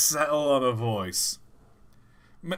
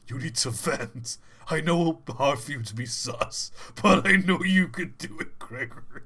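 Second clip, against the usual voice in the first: Freddy voice. Acted. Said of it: "should he be Freddy, though?".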